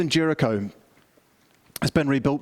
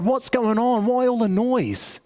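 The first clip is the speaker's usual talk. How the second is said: beggar asking, pitched much higher; a blind man calling into the crowd, asking; puzzled beggar; 7 semitones higher, slower than usual. Retold or imitated. Blind beggar asking the passing crowd, high and puzzled. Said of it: "he says to the crowd".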